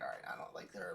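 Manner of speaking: breathy half whisper; whispered aside. Retold or imitated. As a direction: trail off in a whisper. Retold inner thought while watching.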